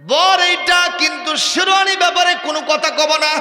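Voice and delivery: blurting it out, loud and high; proud loud announcement; loud declaration. Retold or imitated. Groom to the guests, blurting it out loudly.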